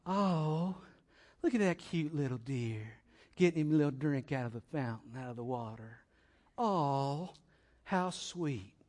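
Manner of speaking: soft, sweet gushing, put on; hushed mock admiration; mock cooing. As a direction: coo over a cute animal, mockingly. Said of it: "The opening of the psalm is not".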